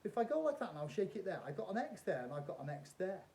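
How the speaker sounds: ordinary delivery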